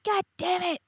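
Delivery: high, exasperated mock groan; complaining to the sky; frustrated. Learning the psychologist is on leave, high exasperated complaint.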